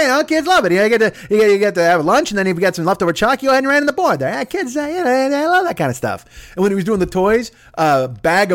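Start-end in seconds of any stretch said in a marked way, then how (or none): 0.0-6.5 Erwin Mainway impression. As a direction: justify it with cheerful confidence. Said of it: "he's like".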